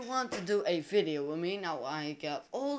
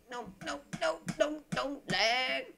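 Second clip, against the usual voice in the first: panicked pleading; 8 semitones higher than usual. Real, not acted. Game lags at a bad moment.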